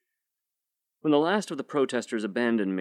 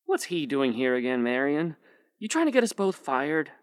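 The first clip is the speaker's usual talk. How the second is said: put-on high squeaky young man; 5 semitones higher than usual. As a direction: pitch up into a squeak. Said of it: "Paul's squeaky voice".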